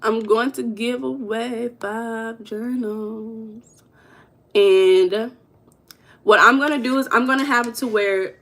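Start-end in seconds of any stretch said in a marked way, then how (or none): 0.0-5.3 slow and measured, trailing long on the end; slow, drawn-out. Real, not acted. Streamer thinking through the giveaway, slow and drawn-out. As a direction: stretch the words out at half speed.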